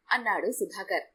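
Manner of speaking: usual speaking voice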